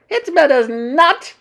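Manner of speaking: high, loud, slow dismissal; shouting witch. A narrator's reading. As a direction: declare it in an imperious witch shout. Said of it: "shouted the Grand High Witch".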